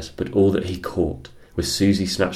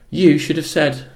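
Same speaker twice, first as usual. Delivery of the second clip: put-on cheeky child; 9 semitones higher, 5 dB louder than usual. Susie caught eating pies.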